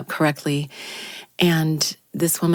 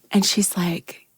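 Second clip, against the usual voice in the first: ordinary delivery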